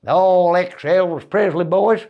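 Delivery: lightly put-on American Southern drawl. Comic describing the [name] band, imitated Southern accent. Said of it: "all talking like that".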